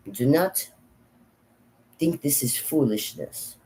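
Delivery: put-on grandmother's thick Slavic accent. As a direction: lecture a child in halting Slavic-accented English. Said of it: "I'm now going to become my grandmother".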